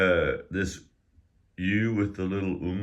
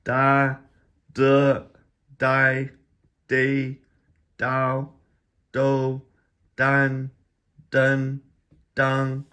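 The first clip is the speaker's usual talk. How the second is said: Da through dang high, loud and level; first tone drill; 8 semitones higher, 7 dB louder, much slower than usual. Shown as a demonstration. Teacher going back to first tone, high flat syllables. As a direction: hold each syllable on one high pitch. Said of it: "let's go back to first tone".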